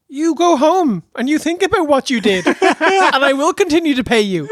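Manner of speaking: high, amused boss voice; mock Tony Khan. Wrestling boss to unhappy wrestler, high amused mock voice. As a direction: indulgent mock boss, laughing it off. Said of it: "And then Tony's like".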